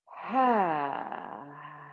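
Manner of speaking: long exhale. Shown as a demonstration. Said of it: "Try one more time. In through your nose".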